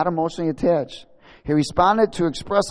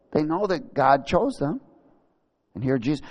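usual speaking voice

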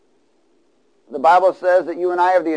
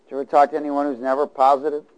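usual speaking voice

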